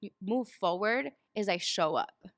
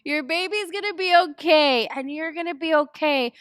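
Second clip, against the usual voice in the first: reassuring moms; 7 semitones higher, 6 dB louder than usual. Retold or imitated. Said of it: "so many moms are like".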